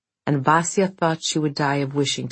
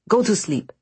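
snapped; 6 semitones higher than usual. Acted. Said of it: "snapped Vasya".

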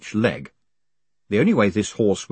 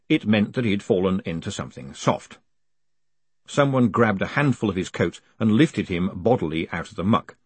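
usual speaking voice

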